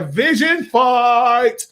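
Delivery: mock-epic slow billing; hyped announcement. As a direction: announce it like a big showdown.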